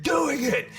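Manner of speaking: high exclamation; rallying cry; emphatic shout. Real, not acted. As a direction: shout the answer. Answer shouted.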